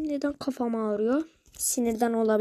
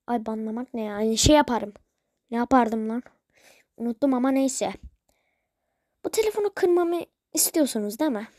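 normal talking voice

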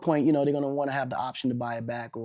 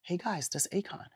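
soft, higher-pitched teasing call; 4 semitones higher, 8 dB quieter than usual. Retold or imitated. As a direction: lightly voice a soft, high-voiced star. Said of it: "[name] see a group of more kids".

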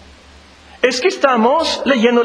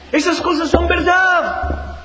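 emphatic shout; 6 semitones higher than usual. Real, not acted. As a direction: cry it out to the congregation. Preacher driving home a point. (exclamación enfática y lenta)

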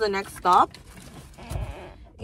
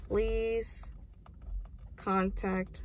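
ordinary delivery

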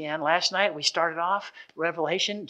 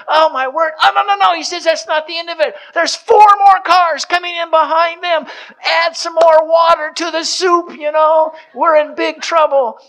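much higher and louder, rising alarm; panicked; 11 semitones higher, 12 dB louder than usual. Retold or imitated. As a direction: sweat bullets as the cars roll in. Father panics at arriving guests.

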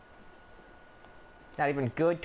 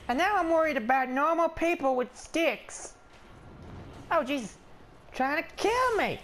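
alarmed complaint; 9 semitones higher than usual. Pretend. Player protests at being attacked.

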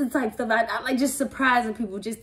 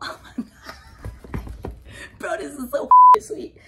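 excited; 6 semitones higher, 7 dB louder, slower than usual. Real, not acted. Unboxing a gift, excited.

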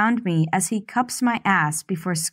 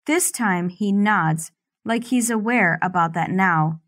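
plain reading voice

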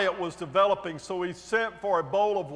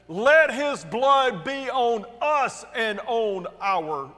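loud, slow, raised declaration; drawn-out, forceful cry; crowd yell; 4 semitones higher, 5 dB louder, slower than usual. A narrator's reading. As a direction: proclaim it as a crowd would. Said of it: "all the people yelled back".

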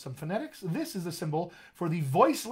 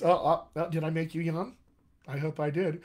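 ordinary delivery

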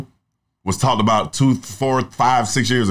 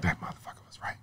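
hushed admission; 4 semitones lower, 17 dB quieter, faster than usual. Retold or imitated. Later grudging admission, hushed.

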